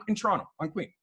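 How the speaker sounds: normal talking voice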